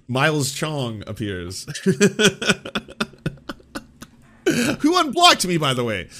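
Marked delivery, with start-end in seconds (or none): 1.7-3.8 high burst of laughter trailing off; amused laughter. Real, not acted. Streamer after announcing Miles Chong appears, amused laughter. 4.8-6.1 high, loud squawk of disbelief; mock outrage. Real, not acted. Streamer to whoever unblocked him, mock outrage.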